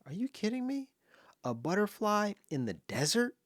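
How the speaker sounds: high, incredulous squeak; disbelief. Retold or imitated. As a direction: act out his disbelief at a butterfly. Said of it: "I was like".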